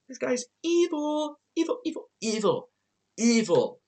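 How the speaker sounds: hammed-up creepy chant; spooky evil. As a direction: intone evil like a cartoon villain. Mock-spooky calling guy evil.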